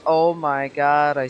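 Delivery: peaking overloaded mic; loud clipped audio; clipping distorted mic. Real, not acted. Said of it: "my audio is peaking".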